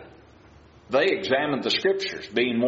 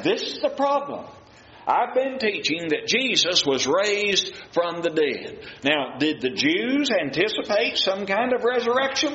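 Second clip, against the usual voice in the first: much higher and urgent, preaching at full force; fervent preaching; 9 semitones higher than usual. Acted. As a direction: drive the point home like a revival preacher.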